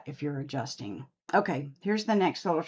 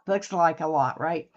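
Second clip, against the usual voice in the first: ordinary delivery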